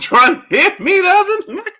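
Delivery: high, helpless laughing speech; real high-pitched laughing talk; breaking up laughing. Real, not acted. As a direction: say it while bursting into laughter. Laughing at own memory.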